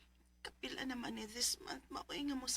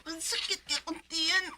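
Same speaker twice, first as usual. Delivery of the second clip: in pain; 5 semitones higher, 10 dB louder than usual. Acted. Son complains to his parents of stomach pain, pained cry.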